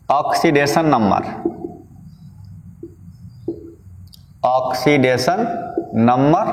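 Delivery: words drawn out slowly for students to write down; dictating a heading word by word; slow dictation. Acted. Teacher dictating a notes heading to students, very slow.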